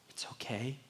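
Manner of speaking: soft, gentle, nearly whispered; reassuring whisper. Real, not acted. Pastor reassuring teenagers in the room, whispered. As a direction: comfort them quietly.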